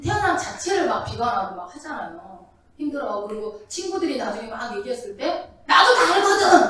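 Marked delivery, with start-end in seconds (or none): 5.7-6.7 high, loud, laughing mock retort; Job retorting. Preacher imitates Job retorting to his friends, mock annoyed. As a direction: play Job snapping back at his friends, half laughing. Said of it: "막 이러잖아요".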